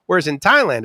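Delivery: normal talking voice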